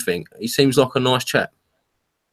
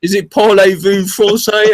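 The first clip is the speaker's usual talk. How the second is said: bad French attempt; 8 semitones higher, 4 dB louder, slower than usual. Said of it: "we didn't know what we was doing".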